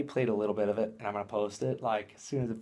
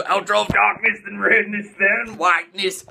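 loud, higher mock-dramatic announcement; epic outro proclamation; 6 semitones higher, 6 dB louder than usual. Vlogger announcing his dramatic outro, loud mock epic. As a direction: boom it like a movie trailer.

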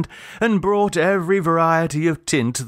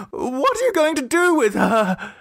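much higher, out-of-breath father after running; panting; 13 semitones higher than usual. A narrator's reading. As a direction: gasp it out after the chase. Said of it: "he panted".

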